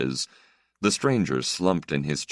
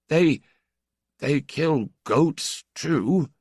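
high, slow, timid stammer; cowering old priest; 11 semitones higher, much slower than usual. Acted. Old priest to the stranger, cowering, high and slow. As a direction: shrink back as you answer. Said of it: "cowering slightly".

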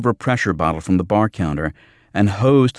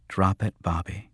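quiet; 5 dB quieter than usual. A narrator's reading. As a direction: tell him quietly to let it go. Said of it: "Jackie said quietly".

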